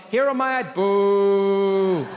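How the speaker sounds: loud candidate call with a long held last word; strange politician voice. Acted. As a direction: boom it out oddly, stretching the end. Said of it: "Get a strange voice".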